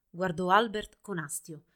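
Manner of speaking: ordinary delivery, nothing marked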